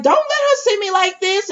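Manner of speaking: high, pleading upset voice; beg, half-hearted distress; lightly acted plea; upset mother. Upset mother quoted, retold.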